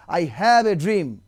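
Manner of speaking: drawn-out orator's line, very slow; mock oratory. Commentator quoting Martin Luther to viewers, slow grand oratory. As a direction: stretch each word like a famous speech. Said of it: "Martin Luther বলেছিলেন".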